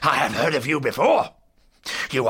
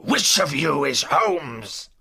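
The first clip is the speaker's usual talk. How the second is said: slow, loud villain's challenge; big, slow, domineering bellow; menacing demand; 7 dB louder, slower than usual. Acted. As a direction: boom it out like an angry intruder. Enraged villain bursts in.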